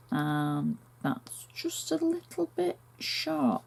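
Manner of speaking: half-voiced whisper to herself; a quick whisper to herself as the insert falls short; whispered aside. Real, not acted. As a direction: breathe it under your breath as the fit goes wrong. Whispered aside over a mistake.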